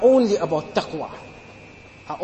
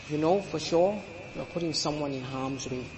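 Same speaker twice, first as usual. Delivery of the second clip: much softer, low, drawn-in echo of the line; soft repeat; 3 semitones lower, 8 dB quieter than usual. Real, not acted. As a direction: drop to a hushed, grave restatement. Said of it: "Because you know for sure you are putting someone in harm's way".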